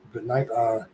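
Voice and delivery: ordinary talk, nothing marked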